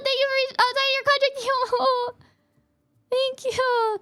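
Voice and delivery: high-pitched, giddy, disbelieving gratitude; overwhelmed thanks. Real, not acted. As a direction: gush helplessly as the gifts keep coming. Overwhelmed thanks for incoming gifts.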